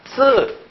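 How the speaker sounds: tense hissed s in 쓰, Chinese style; Chinese pronunciation. Shown as a demonstration. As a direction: model 쓰 high, as the Chinese word for four. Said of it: "중국말로 발음이 뭡니까?".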